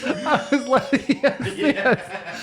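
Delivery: high-pitched, helpless laughing speech; cracking up while telling it; laughing hard. Real, not acted. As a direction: talk through uncontrollable laughter. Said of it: "I was laughing so hard".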